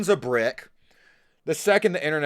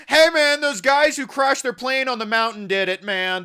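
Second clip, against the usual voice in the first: high, loud panicky bro voice; sudden alarmed hey man; mock panicked roommate; 8 semitones higher, 3 dB louder than usual. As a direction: blurt it out like a spooked roommate.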